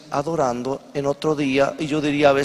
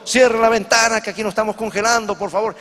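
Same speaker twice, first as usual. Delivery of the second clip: raised, higher-pitched acted demand; urgent complaint; 6 semitones higher than usual. Another freezing passenger calls over to the Italian, urgent complaint. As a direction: call out an urgent, hurried plea.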